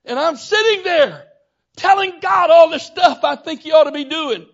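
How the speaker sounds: loud, high preacher's shout; preaching at full force. Acted. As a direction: hammer it home to the congregation.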